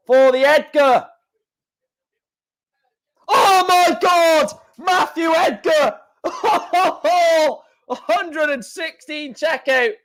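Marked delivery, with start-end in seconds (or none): 3.3-9.9 high, slow shout of disbelief breaking into laughter; ecstatic shout. Real, not acted. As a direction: scream it as the dart lands. Checkout hit, commentator erupts.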